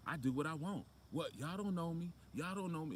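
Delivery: put-on high-pitched, defiant kid mouthing off; unruly talk-show kid. Unruly kid telling off the Maury audience, high and defiant. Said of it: "those unruly children".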